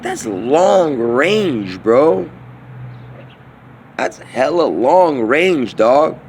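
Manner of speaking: slow, drawn-out, stretched words; amazed realization. Retold or imitated. Realizing his long-range thought connects, slow and drawn out. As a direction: savor each word, stunned. Realizing something, talking to himself.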